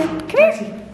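very high coaxing call; calling a puppy. Real, not acted. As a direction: lure the puppy with a squeaky call.

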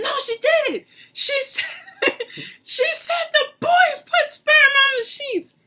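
high, loud indignant shout with a laugh; grandma insisting, shrill and loud; grandma's indignant correction. Retold or imitated. Grandma correcting granddaughter, loud high indignation.

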